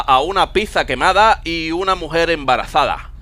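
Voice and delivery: Castilian accent with lisped c and z; acento español. Shown as a demonstration. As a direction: tell the riddle like a Spaniard. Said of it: "con acento español". (adivinanza con acento de España)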